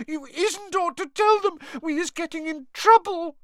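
very high squeaky wail; sobbing. A narrator's reading. House elf begging master not to tell, sobbing. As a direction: plead in a tiny, tearful squeal. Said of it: "sobbed Winky through her hands".

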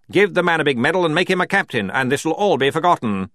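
high, loud, brisk bluster; blustering brigadier. A narrator's reading. Brigadier proposing a deal to the General, blustering. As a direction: wave the problem away loudly.

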